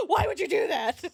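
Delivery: giggling exasperated why; laughing disbelief. Real, not acted. Streamer to friend Terry, laughing in disbelief. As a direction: laugh through the question.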